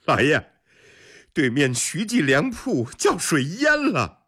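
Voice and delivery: high-pitched gleeful gloating. A narrator's reading. Steward poking his head in to report a rival's flood, gloating. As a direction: crow over someone else's misfortune. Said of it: "幸灾乐祸地说".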